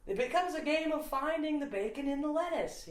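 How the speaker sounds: high-pitched comic routine voice; Jim Gaffigan impression. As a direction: deliver the bit high up in pitch. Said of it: "he's like".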